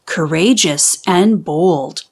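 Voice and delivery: ordinary delivery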